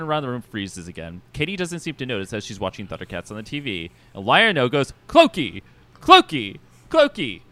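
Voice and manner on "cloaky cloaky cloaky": echoing cartoon character.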